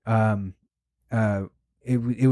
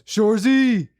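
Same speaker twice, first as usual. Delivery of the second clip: higher, louder call across a parking lot; 9 semitones higher, 3 dB louder, slower than usual. Retold or imitated. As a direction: shout it to get his attention. Dude calls out in parking lot.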